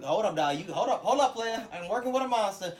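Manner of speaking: loud, high, urgent hold-up; reining someone in. Acted. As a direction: act out pulling back an overexcited friend. Acting friend reining in worked-up buddy.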